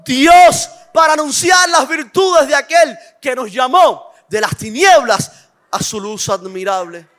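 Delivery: loud, rising sermon proclamation; fiery preaching. Acted. Preacher proclaiming to the church, loud and fervent. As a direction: proclaim it to the congregation. Opening proclamation at full force.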